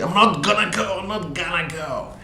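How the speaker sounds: put-on sulky little boy saying no; high stubborn child's whine; child refusing. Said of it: "And he would say".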